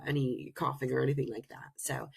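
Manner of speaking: ordinary talk, nothing marked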